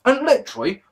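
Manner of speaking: usual speaking voice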